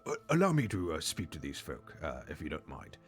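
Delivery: Hemlock voice. Acted. Mushroom Hemlock offers to speak to goblins, soft polite voice. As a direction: politely offer to do the talking, easing off softly.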